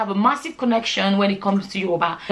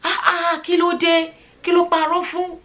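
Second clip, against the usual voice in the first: higher-pitched indignant challenge in Yoruba; fed-up outburst; 8 semitones higher than usual. Acted. Breaking cover to confront people gossipping in Yoruba, fed-up outburst. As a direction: cut in, pitched up, as if you've heard enough. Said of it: "I couldn't take it anymore".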